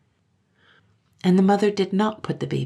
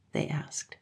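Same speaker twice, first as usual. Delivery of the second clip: ordinary delivery, nothing marked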